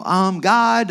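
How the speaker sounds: retold, lifting the voice a little to be heard. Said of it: "So I raise my voice and I go".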